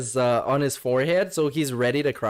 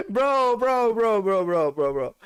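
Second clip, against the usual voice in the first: laughing bro; 6 semitones higher than usual. Real, not acted. Laughing exasperation.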